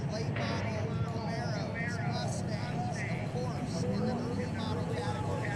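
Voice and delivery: booming over the loudspeaker; PA announcer. Real, not acted. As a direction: call it out over the PA. Announcing cars over loud PA.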